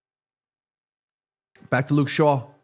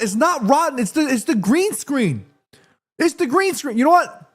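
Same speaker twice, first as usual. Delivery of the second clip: high protest; 6 semitones higher than usual. Real, not acted. Defends banana against chat.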